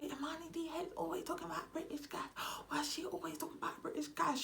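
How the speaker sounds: high, fast whispered gossip with a gasp; gossiping viewer. Acted.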